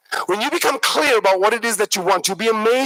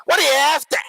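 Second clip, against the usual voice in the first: higher-pitched broad Aussie waiter; rough Australian bogan accent; 5 semitones higher than usual. Acted. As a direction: ask it in a broad Australian twang. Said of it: "in this very broad accent".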